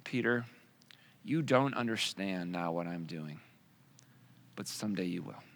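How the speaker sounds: calm a confused friend quietly; gentle Jesus. Retold or imitated. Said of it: "And Jesus replies".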